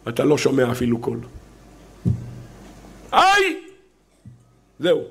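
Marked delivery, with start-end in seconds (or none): 3.1-3.6 high, loud wordless cry; piercingly pitched "ay" of anguish; demonstrated cry from the heart; wordless outcry. Shown as a demonstration. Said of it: "אין מילים".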